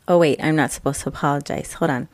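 ordinary talk

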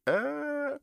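tiny high-pitched whine; emotional whimper. Real, not acted. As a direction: let out a thin, high tearful "uh". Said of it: "I don't know why I'm so emotional".

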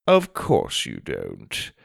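slow, low, dry sarcasm; sarcastic. A narrator's reading. Headmaster answers boy denying bad manners, sarcastic. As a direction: draw it out in lofty disbelief. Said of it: "Edgar said sarcastically".

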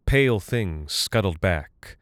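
ordinary delivery

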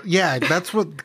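ordinary delivery

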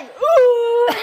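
high, drawn-out 'ooh'; acted retching noise. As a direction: imitate heaving with a high moan. Acts out retching into toilet.

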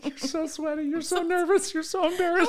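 high, squeezed laughing voice; helpless laughing. Real, not acted. Co-host teasing flustered host, high laughing voice. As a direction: tease through breathless laughter.

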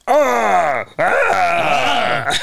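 loud, high-pitched scream; wordless mock shriek; imitated creature cry. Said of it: "He just goes".

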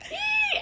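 tiny, very high-pitched squeal; imitated muffled squeal of delight; quiet squeal. Reader squealing beside sleeping husband, quiet squeal. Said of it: "quiet squealing".

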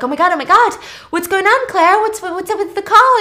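high, loud mock-frantic caller; flustered excitement. Acting him calling Claire back, flustered and excited. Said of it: "That's how excited he was".

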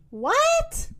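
very high, rising squeal of a question; incredulous high-pitched cow; astonished. A narrator's reading. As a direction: leap right up in disbelief.

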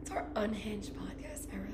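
very soft ASMR-style whisper; playful whisper. Pretend. Co-host topping the podcast era slogan, whispering. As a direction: whisper it, barely any voice.

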